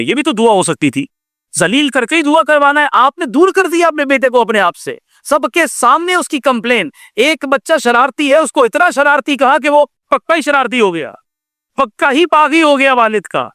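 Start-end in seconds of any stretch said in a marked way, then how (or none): 1.6-13.5 high-pitched, insistent rebuke; reproachful preaching. Real, not acted. Preacher rebukes fathers who shame their sons, reproachful preaching.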